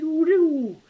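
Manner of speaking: very high loud whoops; whoop sound. Retold or imitated. Marble machine finale, high loud whoops. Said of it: "and then whoop".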